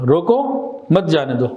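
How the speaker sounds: slowly, روکو alone, then مت جانے دو; pause after روکو. Shown as a demonstration. Teacher repeating the sentence for its meaning, pausing after روکو. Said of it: "روکو پہ اوپر".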